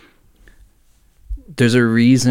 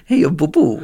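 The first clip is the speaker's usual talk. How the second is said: higher-pitched mock Japanese accent; 5 semitones higher than usual. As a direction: imitate a Japanese accent for laughs.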